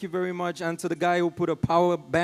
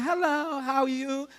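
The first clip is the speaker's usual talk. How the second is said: American customer service voice; 5 semitones higher than usual. Retold or imitated. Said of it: "customer care in America, they are very nice".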